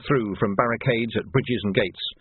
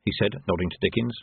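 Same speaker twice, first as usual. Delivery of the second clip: ordinary delivery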